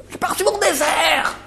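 put-on high, whiny old lady voice; old lady's complaint. Speaker imitates the old lady in the care home, high and whiny. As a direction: pitch it up like a cranky old woman. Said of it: "Elle lui".

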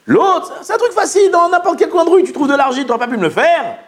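high-pitched, incredulous scolding; exasperated reproach. Acted. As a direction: scold someone for skipping the easy thing. Acts out rebuke of the culprit. (reproche exaspéré)

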